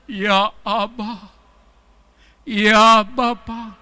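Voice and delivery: very slow intimate call to Abba; tender calling. Shown as a demonstration. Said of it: "Saudara bilang". (panggil Bapa dengan lembut dan pelan)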